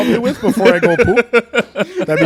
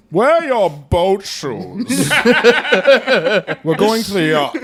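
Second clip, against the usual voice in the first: slowed, languid upper-class drawl; slow Boston Brahmin; slower than usual. Retold or imitated. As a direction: draw it out like an old-money snob. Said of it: "that voice is".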